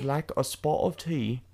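soft, lightly put-on British; a modest try at British; attempted British accent. Shown as a demonstration. Repeating the phrase to partner, light British accent. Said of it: "something you could say in British".

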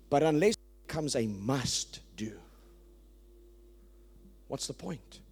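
hushed, breathy whisper for emphasis. Real, not acted. Preacher hushing the congregation to drive it home, whispered. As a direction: lean in and whisper the point. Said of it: "preacher drops voice for emphasis".

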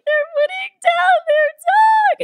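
high, slow, wailing sob-voice; mock crying wail. Telling her fiancé why she's crying, a high wailing sob. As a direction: wail it out like you're bawling. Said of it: "And he starts crying".

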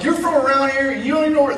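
high, loud berating. Retold or imitated. Tired driver berating the lost cop, high and loud. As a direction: scold him in disbelief. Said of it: "so now I'm berating him".

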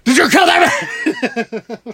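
high, slow, laughing mock yell; mock screaming. Angry M interrogates Bond, hammed-up laughing yell. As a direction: yell it like an unhinged spymaster. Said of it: "he's just screaming".

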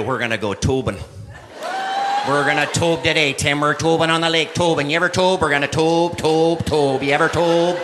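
put-on a light Minnesota accent, eager and pumped; light Minnesota accent, hyped up. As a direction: play the chipper Minnesota buddy with a light Minnesota accent. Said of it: "the Swedish chef from the Muppets".

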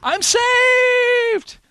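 shrieks in delight, very high and loud; excited shout. Real, not acted. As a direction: yell it out in triumph. Triumphant save roll.

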